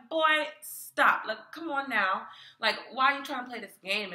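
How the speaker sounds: loud, high-pitched telling-off; exasperated rebuke. Retold or imitated. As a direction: scold him in exasperated disbelief. Acts out rebuking the tenant.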